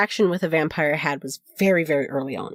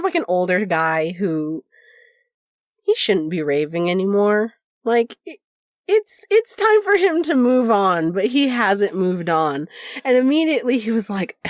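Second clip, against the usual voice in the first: ordinary delivery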